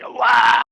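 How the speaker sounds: loud, high yelp of pain; wounded cry. Acted. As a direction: cry out as if struck in the chest. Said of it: "It sounds like".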